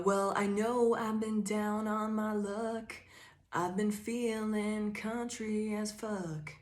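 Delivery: slow hammed-up Southern country drawl; drawled Southern lyric, slowed way down. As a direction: lay on a lazy Southern accent. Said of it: "say you have a really thick Southern accent".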